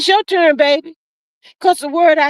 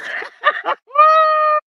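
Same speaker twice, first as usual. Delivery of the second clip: high burst of laughter; 12 semitones higher than usual. Real, not acted. Laughing at a viewer's comment.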